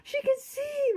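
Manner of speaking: very high, loud cry of amazement; overjoyed high exclaiming; joyful exclamation. A narrator's reading. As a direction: shout the miracle out. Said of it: "Marcus exclaimed".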